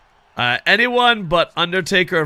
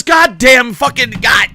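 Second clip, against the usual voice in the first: loud drawn-out curse, slow; frustrated shout; 8 semitones higher, 9 dB louder than usual. Real, not acted. Gamer yelling at the entrance screen, slow loud curse.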